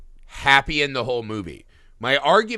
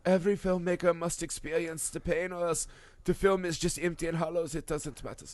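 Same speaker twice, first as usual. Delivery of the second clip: Werner Herzog impression; 2 semitones higher, 7 dB quieter than usual. Asked by his cohost, keeps going as Werner Herzog in soft German. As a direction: muse gravely as a German auteur. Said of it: "why are you doing Werner Herzog again".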